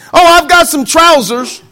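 mock excuse voice. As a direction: play the lazy believer with a slow whiny excuse.

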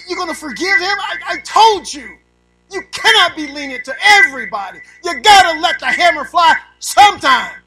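loud, high indignant protest; mock angry complaint. Preacher as Greg scolding the sergeant, loud mock outrage. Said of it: "Greg said something like this".

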